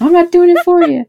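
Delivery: high, loud mom refusal; nagging mom. Retold or imitated.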